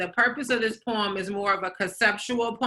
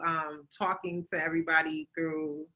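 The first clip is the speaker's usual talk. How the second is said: normal talking voice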